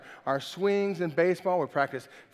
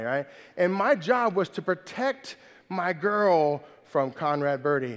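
ordinary delivery

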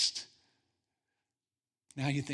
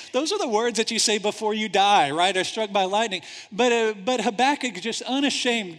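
normal talking voice

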